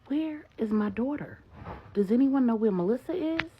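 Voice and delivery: a father desperate to find his daughter; Marcus, frantic. A narrator's reading. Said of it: "And Marcus asks".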